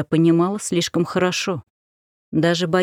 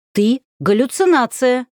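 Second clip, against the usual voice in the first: громко и убеждённо; заяви с полной уверенностью; уверенно; 6 semitones higher, 3 dB louder than usual. Acted. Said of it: "уверенно заявил Ярослав". (уверенное заявление)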